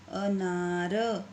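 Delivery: अनार drawn out slowly, syllable by syllable; slow careful. Shown as a demonstration. Teacher to pupils, sounding out अनार very slowly. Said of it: "तो क्या बन जाएगा".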